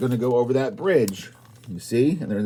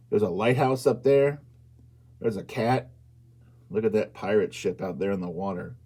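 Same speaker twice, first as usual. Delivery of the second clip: usual speaking voice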